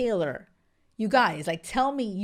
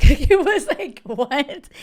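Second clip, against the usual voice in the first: incredulous giggle; laughing disbelief; 6 semitones higher, 10 dB louder, slower than usual. Real, not acted. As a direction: laugh out a high, loud "what".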